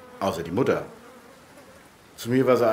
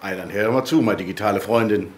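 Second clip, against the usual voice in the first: rural dialect. Acted. Mailbox speaks in dialect.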